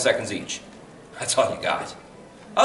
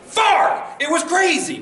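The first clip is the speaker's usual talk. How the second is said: excited; 14 semitones higher, slower than usual. Real, not acted. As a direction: burst out with delight. Answering own question with enthusiasm.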